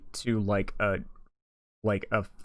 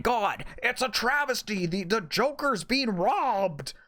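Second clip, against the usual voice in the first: mocking outrage; 7 semitones higher than usual. Mocking Joker fans' outrage.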